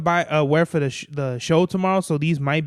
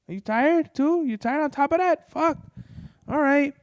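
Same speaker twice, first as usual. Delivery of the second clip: high pet-talk voice; 10 semitones higher than usual. Real, not acted. Talking to the shaking pet on the couch, high baby-talk voice. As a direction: coo at the trembling pet in a high, worried voice.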